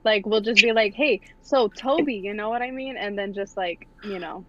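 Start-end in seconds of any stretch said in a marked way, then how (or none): none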